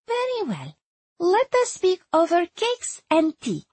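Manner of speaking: put-on high, girlish accented voice; young Erzsébet. A narrator's reading. Young woman agrees to talk over cakes, high and girlish. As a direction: answer brightly in a high accented voice.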